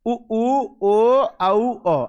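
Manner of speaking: उ, ऊ, ओ, औ called out one by one, लाबbra rounded; vowel demo. Shown as a demonstration. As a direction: sound out each vowel clearly. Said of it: "ध्यान दो बेटा".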